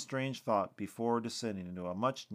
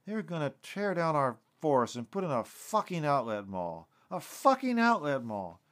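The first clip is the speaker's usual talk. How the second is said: indignant, voice raised in pitch; outraged; 5 semitones higher, 3 dB louder than usual. A narrator's reading. Lucas tells Terry the forest will become a mall, outraged. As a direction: vent it like an angry rant.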